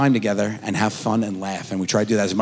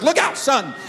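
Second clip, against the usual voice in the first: mock frantic warning; 12 semitones higher than usual. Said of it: "take off to defend him".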